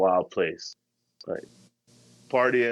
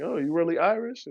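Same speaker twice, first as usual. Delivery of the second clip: higher-pitched surprised question; retold surprise; 10 semitones higher than usual. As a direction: act out being caught off guard, pitched up. Retells his surprise at accent.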